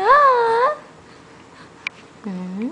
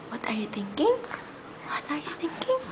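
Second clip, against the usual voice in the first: whispering; 5 semitones lower, 12 dB quieter, much faster than usual. Real, not acted. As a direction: breathe the question with no voice. Same words, whispered then voiced.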